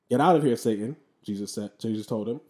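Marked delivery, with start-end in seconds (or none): 0.1-0.9 loud, firm command; commanding rebuke. Acted. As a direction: order the devil away. Jesus rebukes the devil.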